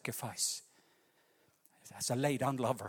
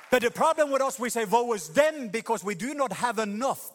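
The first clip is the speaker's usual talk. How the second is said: much higher and louder, proclaiming; full-force preaching; 7 semitones higher, 6 dB louder than usual. Acted. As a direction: hammer the point home.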